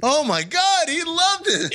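can't believe he loved it; squealing with delighted surprise; amazed excitement. Real, not acted. Amazed the reviewer liked it.

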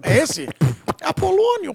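voz aguda de velhinha; voz de velha. Retold or imitated. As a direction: imite a velha surda da praça. Imitando a véia surda. (voz de velhinha aguda e forte)

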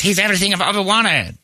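a hint of a dreamy admirer; mock swooning woman.

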